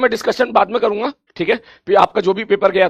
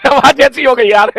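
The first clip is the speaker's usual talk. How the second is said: laughing through the words, higher and louder; 4 semitones higher, 4 dB louder than usual. Real, not acted. Teacher to students about his hoarse voice, laughing. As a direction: laugh at the state of your own voice.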